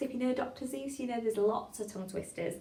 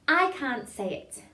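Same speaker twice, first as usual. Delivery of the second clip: slow, careful diction; tongue-twister demo; 4 semitones higher, 5 dB louder, slower than usual. Shown as a demonstration. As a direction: say it slowly for a class to copy.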